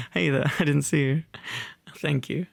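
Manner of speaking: usual speaking voice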